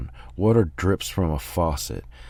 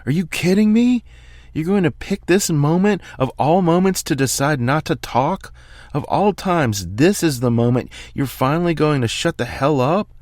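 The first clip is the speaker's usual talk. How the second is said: angry yell; 9 semitones higher than usual. Acted. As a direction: yell it in disbelief, easing a little at the end. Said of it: "I yell at Brad".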